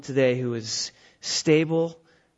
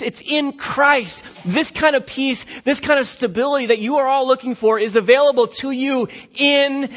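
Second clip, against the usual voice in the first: high, loud preaching build; fervent pulpit crescendo; 8 semitones higher, 5 dB louder, slower than usual. Acted. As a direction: drive it up, pitch raised.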